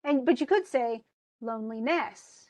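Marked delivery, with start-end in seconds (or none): 1.4-2.4 loneliness as LONE-li-NESS; two stresses. Shown as a demonstration. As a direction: stress first and last syllables of loneliness. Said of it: "both this and this are stressed".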